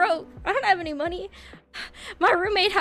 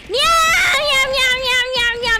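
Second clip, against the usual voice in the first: rapid string of higher, louder meows; playful meowing; 6 semitones higher, 5 dB louder than usual. Pretend. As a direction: meow over and over like a cat. Cat noises between viewer greetings.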